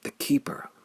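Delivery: plain reading voice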